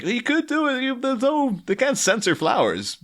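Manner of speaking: high-pitched mock protest to a director; imagined pleading pitch. Arguing with director Joel over censorship, mock pleading.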